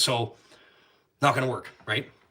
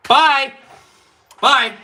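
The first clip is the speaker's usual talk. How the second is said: mocking sing-song bye; 13 semitones higher, 13 dB louder than usual. Friend leaving mockingly.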